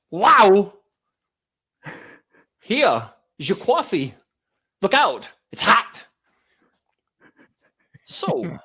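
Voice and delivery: halting, oddly rhythmic celebrity impression; slow it down and break it up in odd places; Christopher Walken. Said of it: "it's rhythmic, but it's not rhythmic".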